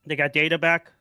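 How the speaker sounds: calling out. Real, not acted. Child calls out question to room.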